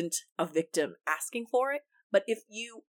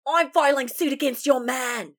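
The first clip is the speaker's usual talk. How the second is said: exaggerated, clumsy Australian accent; bad Australian accent; 3 semitones higher than usual. Shown as a demonstration. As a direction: have a punchy go at an Australian accent. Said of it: "I can't do it. You know me in accents".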